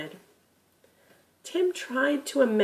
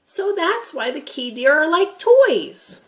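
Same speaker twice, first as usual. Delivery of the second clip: put-on lightly raised, curious boy; a young grandson asking, a bit higher; boy Tim's voice; 8 semitones higher, 7 dB louder than usual. Grandson asking Gramp about the deer, curious boy voice.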